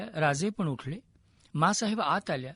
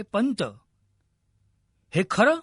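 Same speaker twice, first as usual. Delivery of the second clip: shocked cry; 3 semitones higher, 6 dB louder than usual. A narrator's reading. King turning on his dying mentor for the truth, shocked cry. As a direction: cry out, pushing louder on the question. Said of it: "पंतांच्याकडे वळून राजे ओरडले".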